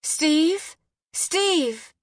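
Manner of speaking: high, urgent call to someone downstairs; high, contained scream for her husband. A narrator's reading. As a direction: call out for him in alarm. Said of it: "screamed down to the large".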